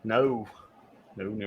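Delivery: usual speaking voice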